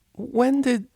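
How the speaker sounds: high, baffled blurt; startled. A narrator's reading. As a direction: sputter the half-question. Cellist shocked again, high.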